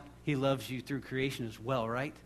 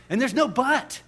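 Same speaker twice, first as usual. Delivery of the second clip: higher, louder, forceful correction; emphatic rebuttal; 8 semitones higher, 7 dB louder than usual. Real, not acted. Preacher countering the congregation's doubts, loud and emphatic. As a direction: shut the objection down loudly.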